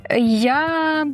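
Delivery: ordinary delivery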